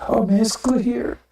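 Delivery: mock sweet talk. Voicing oppressors sweet-talking listeners.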